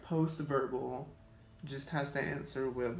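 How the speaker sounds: ordinary delivery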